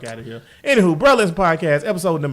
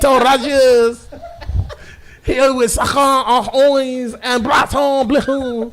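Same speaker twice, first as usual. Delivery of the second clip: put-on French accent; 4 semitones higher, slower than usual. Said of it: "I have a French accent".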